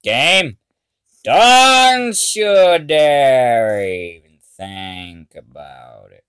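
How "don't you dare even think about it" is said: slow warning. Real, not acted. Slow threat to the game.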